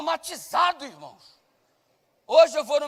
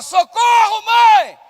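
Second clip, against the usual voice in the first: high, loud frightened yell for mom; panicked child screaming for his mother; child's scream for help; 9 semitones higher, 12 dB louder, much slower than usual. Retold or imitated. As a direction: scream it like a scared kid. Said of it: "E ele gritou na hora".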